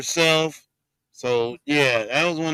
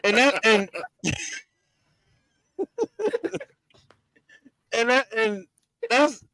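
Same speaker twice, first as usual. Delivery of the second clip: high, helpless laughter between words; real giggles breaking up each restart; laughing; 8 semitones higher than usual. Real, not acted. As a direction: keep trying to talk while cracking up. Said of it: "I can't stop laughing".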